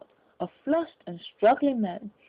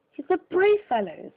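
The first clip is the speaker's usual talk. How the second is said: woman bystander; 7 semitones higher, 4 dB louder than usual. A narrator's reading. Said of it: "said a woman".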